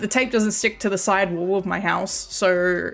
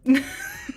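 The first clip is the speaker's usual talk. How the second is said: a quick high-pitched giggle; bright little laugh at herself; 3 dB quieter than usual. Real, not acted. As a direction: laugh up high at your own joke. Laughing at own joke.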